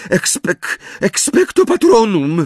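false start, then high faltering incantation; stammered spell. A narrator's reading. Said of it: "wyjąkała Hermiona".

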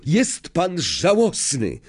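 high, slow indignant cry. A narrator's reading. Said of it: "krzyknął Harry". (oburzony okrzyk, wysokim głosem)

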